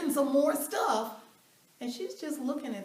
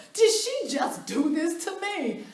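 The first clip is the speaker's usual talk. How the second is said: indignant disbelief; 7 semitones higher, 7 dB louder, slower than usual. Real, not acted. Indignant at the coach.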